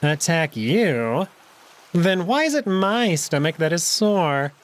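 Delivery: slow, wry retort; mock the claim drily; dry jackal. Neferu retorting to Alex, slow and wry.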